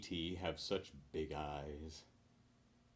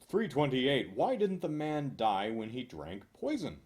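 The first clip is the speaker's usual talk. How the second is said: usual speaking voice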